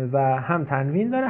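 normal talking voice

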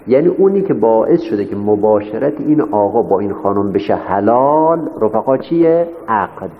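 ordinary talk

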